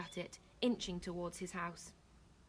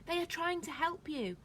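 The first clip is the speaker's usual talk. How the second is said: high-pitched appeal; earnest protest; 9 semitones higher than usual. A narrator's reading. Nawa to the screaming woman, high earnest protest. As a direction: argue back in a high voice.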